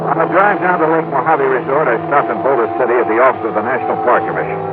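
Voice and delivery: crisp detective voiceover, telling the listener; steady first-person detective narration; story narration. A narrator's reading. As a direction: narrate it like a hard-boiled radio detective. Detective narration between scenes.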